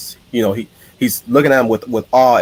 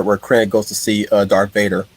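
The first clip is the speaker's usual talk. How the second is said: usual speaking voice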